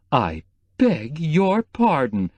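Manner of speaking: slow, hammed-up offended drawl; theatrical mock outrage, stretched out; mock indignation. A narrator's reading. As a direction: pretend to be deeply insulted. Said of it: "said the houseboy with mock indignation".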